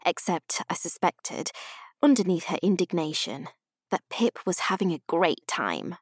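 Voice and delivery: plain reading voice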